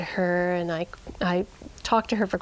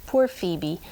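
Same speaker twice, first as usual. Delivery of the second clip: ordinary delivery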